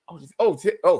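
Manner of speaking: ordinary talk, nothing marked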